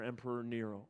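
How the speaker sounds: ordinary talk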